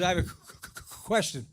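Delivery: teeth-chattering stutter; shivering parrot. Acted. Frozen parrot asking its owner something, shivering stutter. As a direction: catch on the first sound as if frozen. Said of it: "there's the little parrot just shivering".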